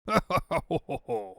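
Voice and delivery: gloating villain cackle; evil laugh. Acted. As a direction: cackle over the coming victory. Villain gloating over his plan.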